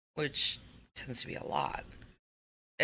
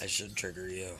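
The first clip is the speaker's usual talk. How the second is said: guy voice; 9 semitones lower, 6 dB quieter than usual. Acted. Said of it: "don't do your guy voice".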